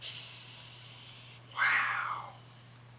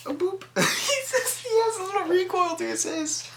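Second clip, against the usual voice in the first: much higher, laughing through the words. Real, not acted. Cooing at the hissing snake, much higher and laughing. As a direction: giggle while cooing at him.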